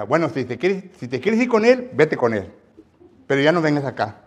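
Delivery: loud, sharply rising angry outburst; offended pastor ordering the couple away. Retold or imitated. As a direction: throw them out in a huff. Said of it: "y se enojó".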